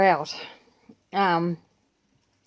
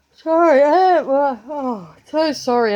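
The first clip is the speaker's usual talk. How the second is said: apologising through a big yawn, higher and louder; yawning apology; 8 semitones higher, 8 dB louder than usual. Real, not acted. Vlogger to viewers, sorry through a yawn. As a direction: stretch the words out mid-yawn. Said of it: "why I'm yawning so much today".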